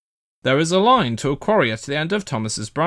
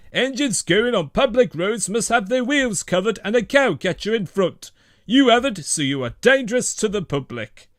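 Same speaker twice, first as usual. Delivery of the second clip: lightly voiced stern policeman, higher; 9 semitones higher than usual. A narrator's reading. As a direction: lay down the law firmly. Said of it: "he spoke sternly".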